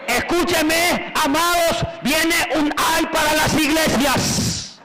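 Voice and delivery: preacher's alarm call to the churches; fiery preaching. Acted. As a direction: thunder the prophecy out slowly. Preaching at full force.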